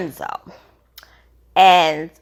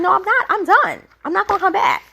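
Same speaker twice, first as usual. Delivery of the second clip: much higher, heated retelling of her rant; mock angry British; 10 semitones higher, faster than usual. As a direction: imitate her telling him off, pitched way up. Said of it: "And she went off".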